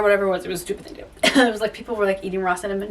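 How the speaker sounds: ordinary talk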